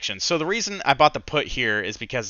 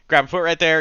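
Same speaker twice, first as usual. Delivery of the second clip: ordinary talk, nothing marked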